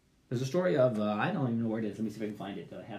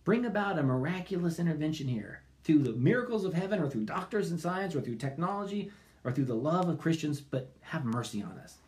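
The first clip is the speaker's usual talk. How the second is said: prayer; 2 semitones higher than usual. Acted. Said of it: "cry out all the more".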